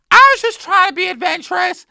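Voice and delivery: a high squeal of an eager baker; Hermione impression. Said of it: "she's like".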